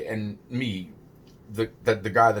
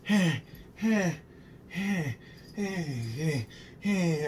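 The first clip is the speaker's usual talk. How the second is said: higher-pitched, soft hey hey, as if settling someone down; calming hey; 5 semitones higher, 4 dB quieter, much slower than usual. Retold or imitated. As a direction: gently repeat hey to calm things. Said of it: "I'm like".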